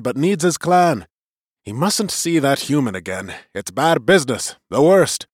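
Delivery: lightly voiced elder, insistent; clan elder. A narrator's reading. Clan elder's voice in narrated story.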